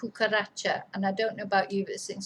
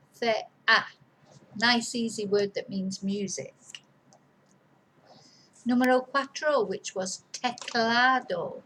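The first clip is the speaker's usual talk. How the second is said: normal talking voice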